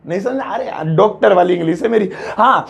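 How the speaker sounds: usual speaking voice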